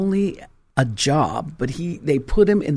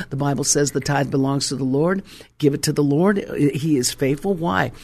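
ordinary talk

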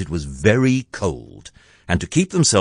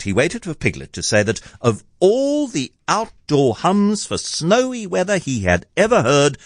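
usual speaking voice

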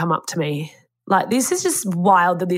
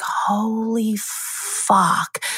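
slow, drawn-out, higher hushed swear; stunned disbelief; 4 semitones higher, much slower than usual. Retold or imitated. Hearing the lifeguard tried to save Chump, stunned slow swear. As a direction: let it fall out of you, floored.

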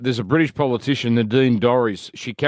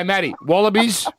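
usual speaking voice

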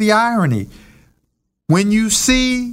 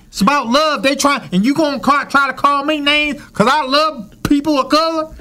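much higher, heated Cleveland man; thick Cleveland accent; 9 semitones higher than usual. Retold or imitated. Quoting the Cleveland man on love and fighting, high heated accent. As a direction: imitate a fired-up guy from Cleveland. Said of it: "in his thickest accent is saying".

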